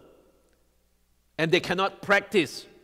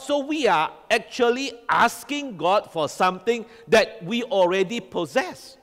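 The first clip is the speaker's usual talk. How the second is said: usual speaking voice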